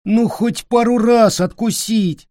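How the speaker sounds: put-on high, begging child voice; begging boy. A narrator's reading. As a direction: beg for just a bite. Peter begs.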